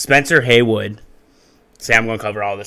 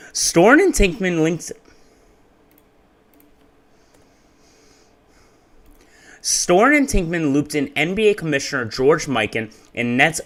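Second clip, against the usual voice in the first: higher, upbeat video narration; YouTuber voice; 4 semitones higher than usual. Shown as a demonstration. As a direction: read it like an energetic YouTube narrator. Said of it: "Let's get YouTuber voice going".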